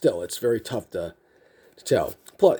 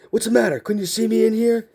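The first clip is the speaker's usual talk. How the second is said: high-pitched indignant imitation; indignant drill sergeant; 9 semitones higher, 3 dB louder than usual. Drill sergeant to the recruit in the dark, high indignant challenge. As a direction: demand it like an affronted drill sergeant.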